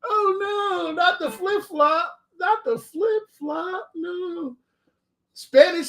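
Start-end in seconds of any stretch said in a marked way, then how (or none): 0.1-4.6 mock dread. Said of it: "You'll be like".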